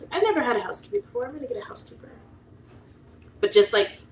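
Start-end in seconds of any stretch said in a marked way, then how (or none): 0.1-2.1 quick, high, gushing excitement; excited. Retold or imitated. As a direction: rattle it off like a giddy first-timer. Quoting her own excited thought.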